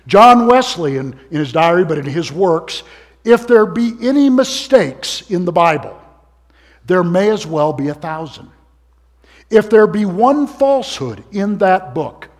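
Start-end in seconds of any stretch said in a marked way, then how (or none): 3.2-12.3 loud, high preaching voice; booming motivational speech. Acted. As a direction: proclaim it loudly from the pulpit. Quoting Wesley at full force.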